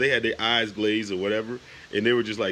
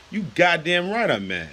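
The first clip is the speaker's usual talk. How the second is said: higher, forceful angry retort; angry outburst; 7 semitones higher than usual. Real, not acted. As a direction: snap back, truly mad. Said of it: "I am freaking pissed".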